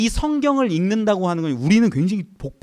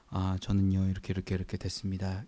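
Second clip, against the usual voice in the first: servant mumbling the text, much softer and faster; mumbled quiet reading; 9 semitones lower, 11 dB quieter than usual. Retold or imitated. Servant reading too softly to his master, mumbled quietly. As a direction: mumble it under your breath like a timid servant. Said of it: "이렇게 읽으면 뭐라고 할까요".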